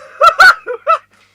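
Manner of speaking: high, loud shriek of laughter. Real, not acted. Reader shrieking with laughter at the Aquarius card. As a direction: burst out in a screaming laugh.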